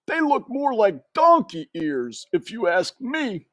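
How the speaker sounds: high gossiping uncle mouse; Uncle Alfred. A narrator's reading. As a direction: sniff it like a nosy uncle.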